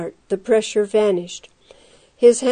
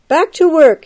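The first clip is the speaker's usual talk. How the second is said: mild shout, higher and louder; shouted order; 6 semitones higher, 5 dB louder than usual. A narrator's reading. Guard shouts to the other prisoners, mildly raised voice. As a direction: call it out to the group. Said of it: "he shouted with a wave".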